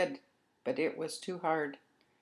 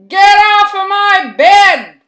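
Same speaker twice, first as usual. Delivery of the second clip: very high, very loud, slow screech; squeaky scream; 14 semitones higher, 23 dB louder, slower than usual. Acted. Baby Mouse screams at the cat to leave, very high screech. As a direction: scream at the cat with all he has. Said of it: "screamed as loud as he could".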